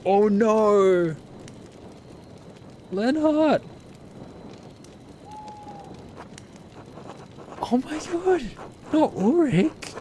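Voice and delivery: real shock breaking into squeals; shocked disbelief. Real, not acted. Player watching a beloved character die: high shocked cries.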